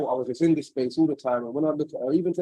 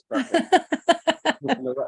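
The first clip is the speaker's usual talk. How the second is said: mock breezy; 13 semitones higher, 4 dB louder than usual. Mock breezy past self.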